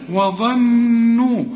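وظنوا with the doubled noon hummed long through the nose; held ghunna. Shown as a demonstration. Teacher demonstrating the doubled letter, وظنوا with long nasal hum. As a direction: stretch the nasal "nn" in وظنوا very slowly. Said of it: "الحرف المشدد يغن".

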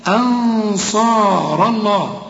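أنصار الله recited with a thick heavy ra and a thick Allah. Shown as a demonstration. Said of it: "الراء هنا مفخمة".

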